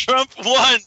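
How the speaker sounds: mock troll. Friend repeats the troll line.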